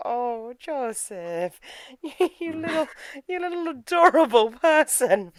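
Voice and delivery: high, slow gushing coo; adoring coo. Acted. Host to adorable Joseph, melting coo. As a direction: coo as if at a cute little thing.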